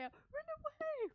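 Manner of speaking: high, loud yell to flee; panicked yell. Acted. Fleeing character heard by the others, panicked yell. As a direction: holler it as you flee. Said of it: "All you hear is Vale".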